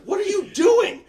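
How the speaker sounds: high, loud imitation of an upset girl; hammed-up falsetto scolding; mock outrage. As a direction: shriek it like a girl scolding over the phone. Retold angry phone call from girl.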